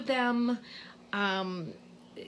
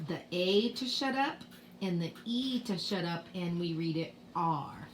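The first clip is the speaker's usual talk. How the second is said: ordinary talk, nothing marked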